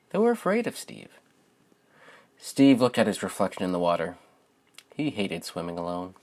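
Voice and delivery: gentle, expressive storybook narration; lilting read-aloud voice for young listeners; reading to children. Acted. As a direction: read it like a bedtime picture book for kids. Reads picture book aloud.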